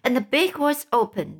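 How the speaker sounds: ordinary delivery, nothing marked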